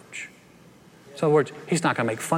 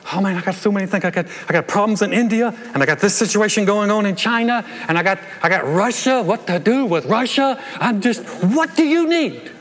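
hammed-up harried boss, higher; mock overwhelmed God; 6 semitones higher, 4 dB louder than usual. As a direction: play God swamped with world problems. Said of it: "It's not like he's like".

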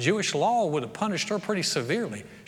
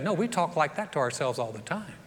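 usual speaking voice

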